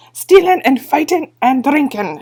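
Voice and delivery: loud, gleeful hissed chant; the blue men cheer their own fame; cheerful shout. Acted. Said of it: "shouted the blue men cheerfully".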